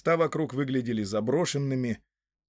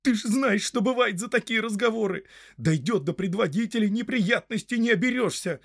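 high, agitated scolding; shouted warning; 10 semitones higher, 4 dB louder than usual. A narrator's reading. Villagers warn Hob about the chief, high and agitated. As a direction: warn him urgently, pitch way up. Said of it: "закричало сразу несколько голосов".

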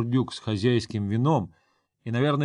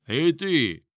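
put-on loud, high drunken holler; drunk shout; 5 semitones higher, 4 dB louder than usual. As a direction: bellow it like a tavern drunk. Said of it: "пьяно заорал Югор".

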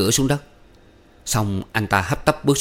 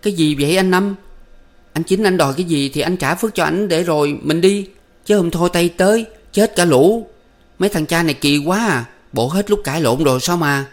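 higher, louder, pressing questions; urgent questioning; 8 semitones higher, 3 dB louder than usual. A narrator's reading. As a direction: press him insistently for an answer. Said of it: "hỏi dồn".

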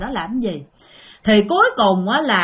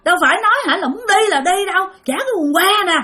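retold yelling; 7 semitones higher than usual. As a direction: shout back at the ghost wife, outraged. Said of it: "Tự nhiên la làng lên vậy".

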